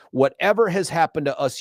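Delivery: usual speaking voice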